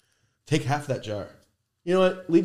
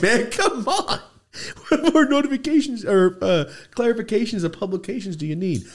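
mock bitching; 11 semitones higher than usual. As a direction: whine at Instagram like a fed-up star. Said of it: "I'm just bitching".

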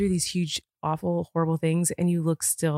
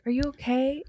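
gentle concern; 8 semitones higher than usual. Retold or imitated. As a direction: ask it gently, like you mean it. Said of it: "you'd be gentle about it".

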